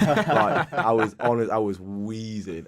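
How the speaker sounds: laughing through the words; words spilling out in a laugh. Real, not acted. Laughing while recalling wheezing.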